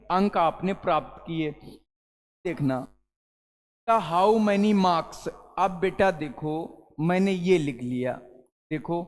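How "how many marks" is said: how many marks said very slowly with no r in marks, maks; silent r. Shown as a demonstration. Teacher slowly models marks without the r for students. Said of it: "मार्क पढ़ोगे या मार्क्स पढ़ोगे".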